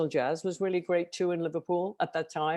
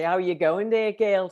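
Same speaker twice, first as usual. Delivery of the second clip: Scouse street greeting, pitched up; Liverpool accent; 3 semitones higher, 3 dB louder, slower than usual. Shown as a demonstration. Showing how locals talk, lifted Liverpool accent. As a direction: greet a friend in broad Scouse. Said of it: "you know, with the accent".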